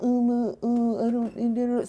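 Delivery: soft, dragging mock mumble of excuses; chastised child. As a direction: mimic a scolded kid's wishy-washy stammer. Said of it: "You sound like a child that's being chastised".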